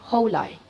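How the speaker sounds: "holai" as a blend of hotel and airline; imitated mispronunciation. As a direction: say "holai", ho followed by lie. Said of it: "like a combination of airline and".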